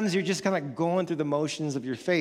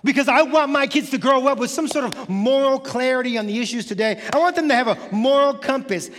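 parent deciding on church; 5 semitones higher, 4 dB louder than usual. Retold or imitated. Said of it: "and we're like".

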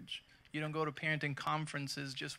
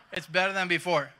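louder, through a laugh; 3 semitones higher, 10 dB louder than usual. Real, not acted. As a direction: repeat it laughing at the joke.

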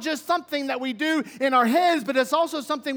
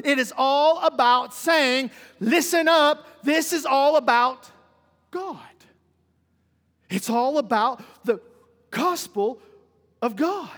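loud, raised and drawn-out proclamation; forceful preaching; 3 semitones higher, 5 dB louder, slower than usual. Real, not acted. Preacher telling congregation it is all about God, forceful. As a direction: build to a slow, ringing declaration.

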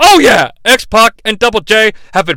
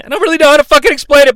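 fast, higher burst of exasperation; exasperated; 8 semitones higher, 4 dB louder, much faster than usual. Real, not acted. Host gives up explaining the chop, fast and exasperated. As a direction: blurt it out, fed up. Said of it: "exasperated rush".

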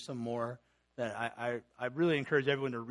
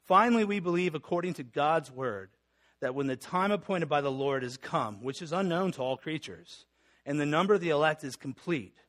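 louder, emphatic confession reading; formal reading; 6 dB louder than usual. Acted. Pastor reading the Belgic Confession aloud, emphatic formal reading. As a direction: proclaim the confession with conviction.

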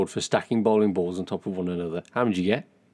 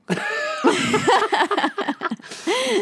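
real helpless squeal of laughter, very high; 5 dB louder than usual. Real, not acted. Cracking up over the fake-looking bowling balls, high squealing laugh. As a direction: let a giggle burst out high and loud. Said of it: "I love your laugh on it".

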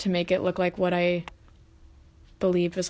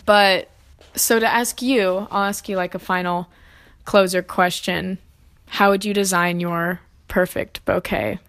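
ordinary delivery

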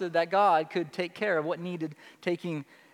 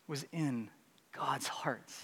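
hushed emphasis; 6 semitones lower, 12 dB quieter, slower than usual. Acted. Key phrase said softly.

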